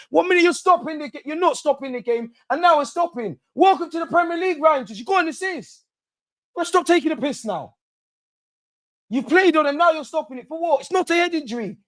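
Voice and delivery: high, strained furious ranting; exasperated fan ranting at the referee; outraged rant. Real, not acted. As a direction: rage at the ref's decisions.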